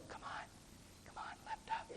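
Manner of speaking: whispered urging; very soft, secretive encouragement; hushed coaxing. Acted. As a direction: coax under your breath. Acted coaxing plea.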